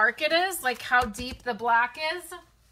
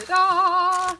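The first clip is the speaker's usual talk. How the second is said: higher, louder triumphant reveal; excited ta-da; 7 semitones higher, 6 dB louder than usual. Real, not acted. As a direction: present it like a grand unveiling. Unveiling the package to viewers.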